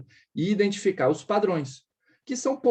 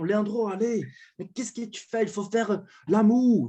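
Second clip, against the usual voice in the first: friends' voice; 2 semitones higher than usual. Retold or imitated. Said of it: "Meus amigos falavam".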